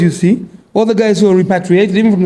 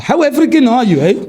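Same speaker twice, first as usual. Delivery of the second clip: higher-pitched South African Boer impression; Boer accent; 5 semitones higher than usual. Boer questioning someone's African roots, higher Boer accent. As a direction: quiz them in a put-on Boer accent. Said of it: "You've nailed that accent".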